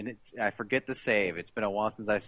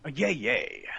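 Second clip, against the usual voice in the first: Snake voice; slower than usual. Pretend. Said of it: "do a yay yay in Snake's voice".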